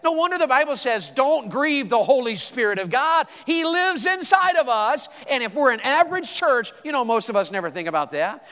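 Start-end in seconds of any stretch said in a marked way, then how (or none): 0.0-6.7 high, forceful pulpit delivery; emphatic preaching. Real, not acted. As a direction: lift the voice and proclaim it.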